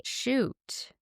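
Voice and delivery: a quick high "shoot" of realisation; sudden dismay. Real, not acted. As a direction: catch yourself, suddenly annoyed. Realises she doesn't know closing time.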